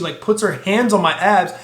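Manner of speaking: normal talking voice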